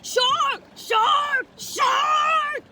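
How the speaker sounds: loud panicked shout of shark; yelled warning. A narrator's reading. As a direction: yell it in terror. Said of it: "he yelled".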